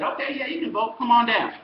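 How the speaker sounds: high, loud come-on invitation; mock welcoming. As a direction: call them over with breezy, put-on generosity. Officials acted inviting people to vote.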